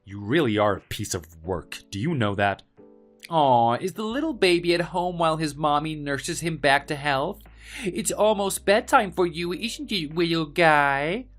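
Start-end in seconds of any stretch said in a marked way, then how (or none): 3.3-11.2 mocking baby talk. Villain mocking him as a baby.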